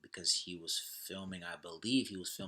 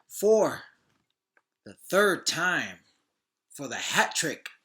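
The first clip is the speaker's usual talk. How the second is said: hyped host intro; 7 semitones higher, 11 dB louder, slower than usual. Real, not acted. Hosts introducing the episode.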